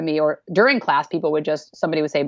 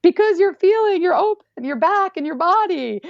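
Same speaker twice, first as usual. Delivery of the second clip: excited explaining; 12 semitones higher than usual. Retold or imitated. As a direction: burst out with eager reassurance. Teacher's excited answer retold.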